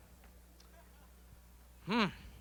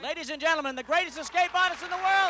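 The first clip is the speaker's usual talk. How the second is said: higher, building announcer introduction; showman announcer; 10 semitones higher than usual. Real, not acted. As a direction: present the star to the audience. Host introduces the artist again.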